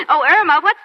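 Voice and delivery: loud, high call across the room. Acted. Nurse calls out to a colleague, loud. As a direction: call her name across the ward.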